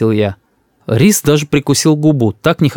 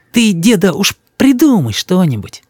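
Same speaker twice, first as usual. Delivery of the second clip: put-on higher-pitched child voice; light childish wheedling, coaxing grandpa; pleading grandson; 7 semitones higher than usual.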